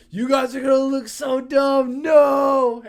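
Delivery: put-on high, loud drunk whine of protest; whiny protest. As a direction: protest like a drunk friend being shaved. Drunk friend protests shaving.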